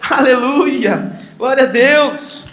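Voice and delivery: loud, drawn-out praise cry; exclamação de louvor. Real, not acted. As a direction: stretch the praise out slowly and loudly. Preacher exclaims praise to congregation. (louvor em voz alta e arrastada)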